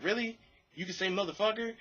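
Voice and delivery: a hint of a quirky, high questioner; weird speaking pattern. Brother questioning the swearing, lightly imitated weird speech. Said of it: "the brother had this weird speaking pattern".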